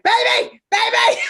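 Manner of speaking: put-on high, loud alarmed yelling of baby; husband yelling for his wife in alarm; panicked shout. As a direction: call out in sudden panic from outside. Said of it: "I hear my husband going".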